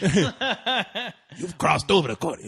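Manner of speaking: put-on slow, drawn-out accented line through laughter; Rudy. Janitor pronounces verdict on guest, slow accent. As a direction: imitate the janitor slowly, amused.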